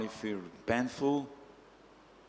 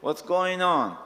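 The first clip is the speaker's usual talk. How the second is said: raised, high-pitched mock scolding; upset king; 4 semitones higher, 5 dB louder than usual. A king rebukes his palanquin carrier, upset and loud. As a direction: scold loudly as an indignant king, pitch climbing. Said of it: "Prachinabhari become upset".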